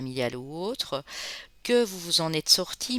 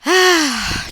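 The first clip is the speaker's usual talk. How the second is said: delighted ah; 12 semitones higher, 13 dB louder than usual. Real, not acted. Joyful greeting to friends from Mauritius.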